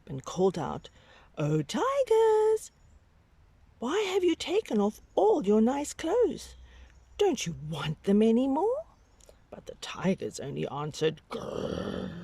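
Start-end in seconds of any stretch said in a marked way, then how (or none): 1.4-8.9 put-on high, piping little boy voice; calling little boy. Babaji calls out to tigers. 11.3-12.1 low growl. Acted. Tigers growl.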